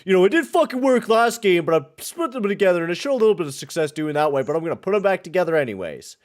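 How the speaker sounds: high, loud, mocking imitation of the coach; sarcastic coach impression, pitched high and loud. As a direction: ham up the clueless coach, way up in pitch. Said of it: "and then was like".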